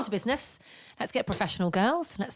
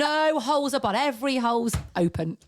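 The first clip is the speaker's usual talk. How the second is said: mock proclamation; 6 semitones higher than usual. Cohost mocks the misheard phrase, in a hammed-up voice. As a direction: announce it grandly as a joke. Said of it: "Imagine going around saying".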